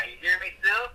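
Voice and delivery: tinny distorted phone line; sounding like a tin can. Real, not acted. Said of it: "Frickin' Wizard of Oz".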